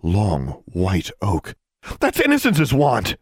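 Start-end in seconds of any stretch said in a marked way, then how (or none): 2.0-3.2 high, loud cry of alarm; alarmed outcry. A narrator's reading. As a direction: blurt it out, pitch leaping up. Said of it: "she cried".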